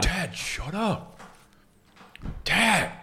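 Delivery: slow, soft put-on teenage whine; exasperated goth son. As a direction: drag it out, embarrassed by Dad. Plays embarrassed goth son.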